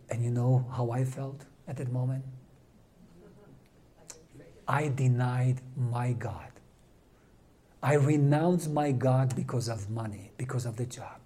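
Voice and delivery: a hint of guilty regret; remorseful confession. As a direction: admit the shameful moment quietly. Guilty confession of denying God.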